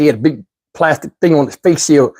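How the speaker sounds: ordinary talk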